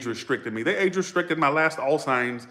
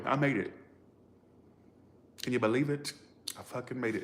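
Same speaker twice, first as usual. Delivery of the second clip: soft, real disbelief close to tears; quietly overcome, keeping tears back; about to cry; 8 dB quieter than usual. Real, not acted. Reader marvels at having made it, about to cry. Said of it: "I'm about to cry".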